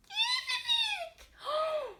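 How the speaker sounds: high startled gasp. Acted. Reader gasping as the lion lets out a sound.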